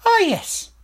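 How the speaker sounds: high, loud hasty royal voice; hurried king. Acted. King cutting off talk of the dead seamstress, high and hurried. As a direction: brush it off fast like a guilty king. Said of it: "said King Fred hurriedly".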